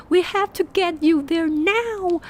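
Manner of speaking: high, loud, acted urgency; urgent insistence. Urgent drive to the hospital.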